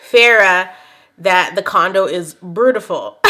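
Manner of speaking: usual speaking voice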